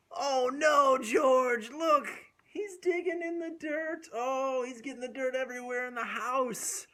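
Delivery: high, loud mock panic; hammed-up shout. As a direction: shout it for the kids' excitement. Story climax.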